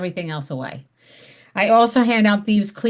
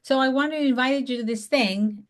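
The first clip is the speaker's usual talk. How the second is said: upbeat, pitched-up hello on the phone; friendly phone greeting; 5 semitones higher than usual. Shown as a demonstration. Answering caller invites Betty to an event, cheerful. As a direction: greet her like an old friend. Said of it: "they wanna hear that excitement in your voice".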